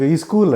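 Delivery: "స్కూల్" mispronounced as "ee-skool"; extra leading vowel. Shown as a demonstration. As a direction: say "స్కూల్" with an "ee" before the s. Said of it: "అంటారు".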